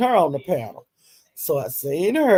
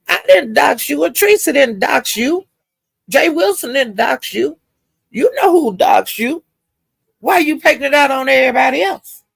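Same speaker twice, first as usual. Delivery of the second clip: higher, indignant retelling voice; scolding Pizarro; 8 semitones higher than usual. Retold or imitated. Pizarro scolding the woman on live, high and indignant. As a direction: call her out point by point.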